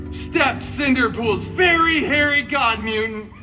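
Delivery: ordinary talk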